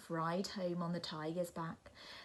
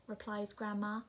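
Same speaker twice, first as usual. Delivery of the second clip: plain reading voice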